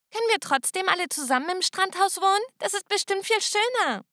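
put-on high, wheedling kid voice; cheeky little sister. Little sister asks Dad to still move to the beach house, high wheedling. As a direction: beg sweetly like a spoiled child.

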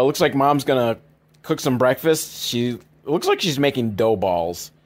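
usual speaking voice

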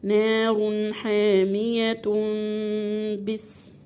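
chanted demo of "نار حاميه", tanween held as meem; iqlab with ghunnah. Shown as a demonstration. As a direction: recite "نار حاميه" slowly, ending in a long hummed "-tum" before "بس". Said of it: "يبقى هتنطقها ازاي؟".

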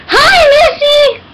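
high, loud, stretched greeting; excited squeal. Real, not acted. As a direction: squeal hello to the dog. Greeting the dog.

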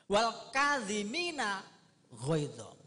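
slow reverent Quran chant; Quranic recitation. Acted. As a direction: intone the verse like a reciter. Quran verse quoted in sermon.

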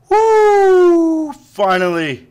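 high joyful cheer, then a loud sigh of relief; triumphant whoop. Real, not acted. Gamer whoops after finally beating the level, elated. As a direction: let it burst out after beating it.

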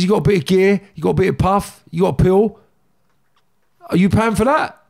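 loud, high, punched-out list; re-enacted shouting, short of screaming; retold irate challenge. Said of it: "screaming at him when I'm talking".

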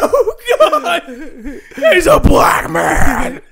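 loud, high, drawn-out horrified yell; hammed-up shriek of disbelief, laughing; mock shocked customers. Car buyers finally meet the salesman, mock shock.

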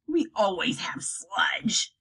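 slow, hushed troll whisper; troll answers about sludge in a whisper. Acted. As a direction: drag it out lazily.

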